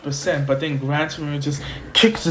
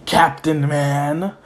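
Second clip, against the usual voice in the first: slow, drawn-out announcement at usual pitch; amused acceptance; much slower than usual. Retold or imitated. Friends accepting Izuku's hero name, amused and drawn-out. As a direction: stretch the name out, playfully conceding.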